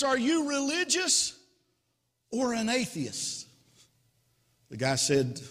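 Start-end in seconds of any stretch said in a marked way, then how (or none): 0.0-3.5 high voice easing down at the end; storyteller voicing a keen rescuer; eager questioning. Rescuer asking the jumper about faith, high and eager.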